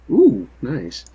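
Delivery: ordinary talk, nothing marked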